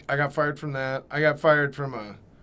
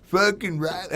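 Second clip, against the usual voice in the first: put-on drunk customer; 5 semitones higher, 6 dB louder than usual. Drunk drive-thru customer mocking the worker, loud slurred-style heckle. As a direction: call out like a mocking drunk, rising high on the question. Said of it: "drunk or stoned".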